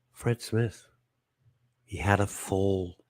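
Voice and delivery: low, hushed and slowing, gravely concerned. Acted. Host mimics solemn news of an elderly fall, grave. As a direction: break it softly, like serious news. Contrast with shrugged-off tumble.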